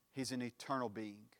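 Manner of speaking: normal talking voice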